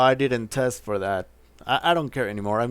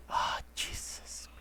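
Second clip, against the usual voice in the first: exasperated whisper; 14 dB quieter, slower than usual. Real, not acted. Exasperated after bad choice.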